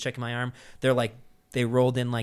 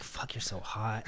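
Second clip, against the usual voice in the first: retold smitten murmur; 11 dB quieter, slower than usual. Replays his dazed admiration of medic.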